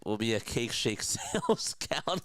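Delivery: ordinary delivery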